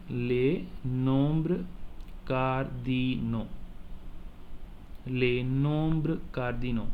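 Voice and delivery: les nombres cardinaux, slow and spaced; slow model for repeating. Shown as a demonstration. Teacher asks class to repeat the title, slow. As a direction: say cardinaux slowly so they can copy. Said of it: "Please repeat".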